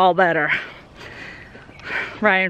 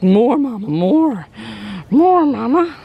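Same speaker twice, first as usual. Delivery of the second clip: voicing the eager goat; 2 semitones higher, 3 dB louder, slower than usual. Owner speaks for a goat wanting scratches, lightly pleading. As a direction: playfully beg on the goat's behalf, building. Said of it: "You see her step forward, like".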